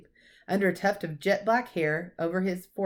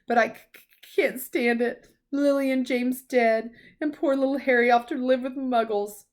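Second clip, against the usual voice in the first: higher, stammering through tears; sobbing; 7 semitones higher, 4 dB louder than usual. A narrator's reading. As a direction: grief spilling over, words catching. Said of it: "sobbed Hagrid".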